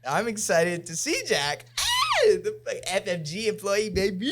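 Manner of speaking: high, loud hype shout; hammed-up excitement. Host cheers for friend's first big event, over-the-top excitement. Hamming up excitement to meet Jack.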